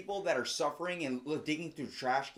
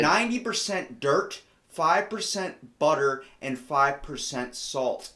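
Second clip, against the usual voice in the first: each figure punched, louder and slower; louder, slow, stressed list of percentages; slow emphatic listing; 3 semitones higher, 8 dB louder, slower than usual. Real, not acted. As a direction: hammer every number home. Listing mud cookie recipe, emphatic.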